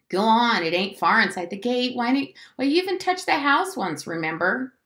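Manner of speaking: put-on high, pushy child's urging; insistent goading. A narrator's reading. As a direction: goad him on, pitch climbing. Boy urged to fetch the tire.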